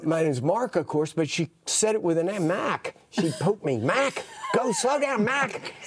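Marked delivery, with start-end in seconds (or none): 3.8-5.7 put-on cranky old woman voice, higher and slow; imitating mean old lady. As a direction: nag the driver from the back seat. Old lady poking, scolding driver.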